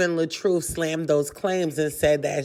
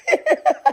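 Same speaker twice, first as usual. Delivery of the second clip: high squealing laugh; burst of laughter; 4 dB louder than usual. Real, not acted. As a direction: crack up at yourself. Laughing after reading medical jargon.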